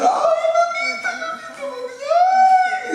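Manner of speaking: high, piercing mock wail of a grieving daughter; imitating wailing daughter. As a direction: shriek it like she's throwing herself on the coffin. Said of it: "la muchacha empezó a gritar". (lamento agudo imitando a la hija en el entierro)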